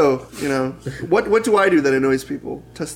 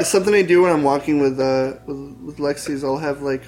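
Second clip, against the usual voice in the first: ordinary talk, nothing marked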